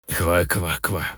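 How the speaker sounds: soft laughing frog croak. Acted. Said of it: "засмеялась лягушка".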